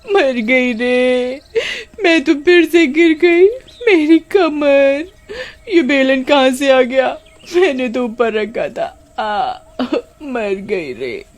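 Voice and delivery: high slow moaning over her back; wailing in pain. Acted. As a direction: howl and whimper, hurt from the fall. Slipped and fell on back. (कमर के दर्द से कराहती, रोती आवाज़)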